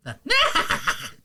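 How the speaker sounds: very high cartoon villain cackle; put-on sorcerer's shrill laugh; Babidi. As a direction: cackle high like a silly evil wizard. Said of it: "Your Bobbity's fantastic".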